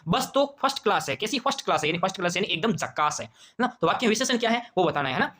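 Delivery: normal talking voice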